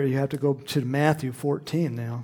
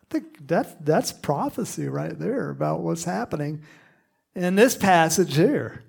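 normal talking voice

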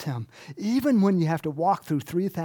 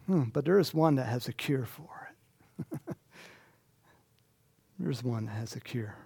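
hushed whisper; 3 semitones lower, 5 dB quieter than usual. Real, not acted. Hushed reveal of the cure.

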